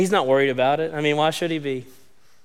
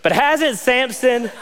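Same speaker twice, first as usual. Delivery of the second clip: laughing; 8 semitones higher, slower than usual. Real, not acted.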